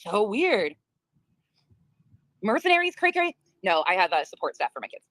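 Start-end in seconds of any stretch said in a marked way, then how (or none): 2.4-3.4 high, mocking cray cray; mocking singsong. Real, not acted. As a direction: tease it up high. Mocking a rival.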